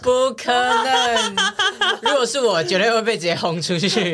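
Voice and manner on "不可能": slow, high laughing protest; drawn-out giggly denial; laughing disbelief. Real, not acted.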